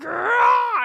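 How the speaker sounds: sharp high loud cry of pain; pained scream. Retold or imitated. Girl in agony from the headache screams high and loud. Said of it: "Fairchild starts screaming".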